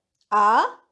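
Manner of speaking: doubt it with a loud, dipping-then-rising "啊"; doubtful. Shown as a demonstration. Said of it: "expressing doubts".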